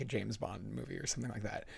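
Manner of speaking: ordinary delivery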